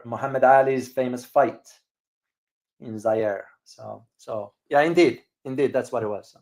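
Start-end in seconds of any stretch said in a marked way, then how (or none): none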